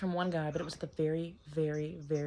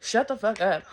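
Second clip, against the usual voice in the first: retold snap back; 3 semitones higher, 5 dB louder, slower than usual. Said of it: "I was like".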